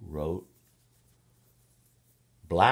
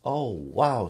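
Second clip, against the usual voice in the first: delighted wow; 5 semitones higher, 6 dB louder than usual. Acted. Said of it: "said the ladies".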